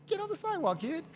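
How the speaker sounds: high-pitched telling-off; acted cop's call to a kid; mock officer's order. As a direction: order a kid to move along, high and sharp. Officer scolding a kid thought asleep.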